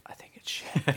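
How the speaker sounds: whispered, sheepish admission; whispered confession. Retold or imitated. Confessing to his buddy, whispered and sheepish. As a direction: admit it in a whisper.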